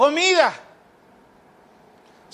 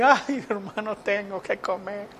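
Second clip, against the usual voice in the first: softer, lowered tearful moan; mock crying; 4 semitones lower, 6 dB quieter than usual. Preacher imitates brethren crying over food, mock weepy. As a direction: imitate someone sobbing out a complaint. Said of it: "Están llorando y".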